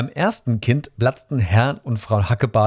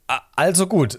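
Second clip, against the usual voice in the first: hesitant, higher-pitched giving in; slightly intimidated; 7 semitones higher than usual. A narrator's reading. Registrar yielding to the muscular mother, slightly intimidated. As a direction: stammer the first sound, then concede. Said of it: "ein wenig eingeschüchtert".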